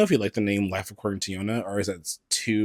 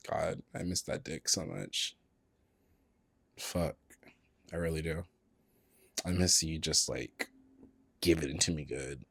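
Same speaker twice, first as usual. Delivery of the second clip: low and soft; 4 semitones lower, 9 dB quieter than usual. Real, not acted. Wistful lust, voice drops.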